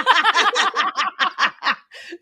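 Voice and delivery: real, helpless laugh. Real, not acted. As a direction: crack up mid-conversation. Cracks up at a remark.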